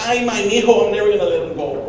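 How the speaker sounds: high, loud gushing mom; mock gushing mother; doting mom voice. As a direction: coo over her baby boy. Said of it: "My mom was like".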